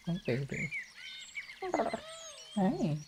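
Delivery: normal talking voice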